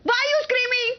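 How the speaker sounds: high, insistent indignant questions; angry challenge. Real, not acted. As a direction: fire the questions back in the row. Heated quarrel, repeated challenge.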